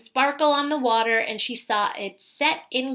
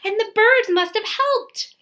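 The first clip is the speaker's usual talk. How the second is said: high, bright exclamation of delight; thrilled realization; 8 semitones higher, 4 dB louder than usual. A narrator's reading. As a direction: cry out in joyful discovery. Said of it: "knelt in delight".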